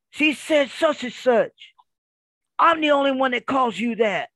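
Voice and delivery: mock a wife's territorial outrage; imitated jealous wife. Wife challenges husband over the caller's words, imitated indignation.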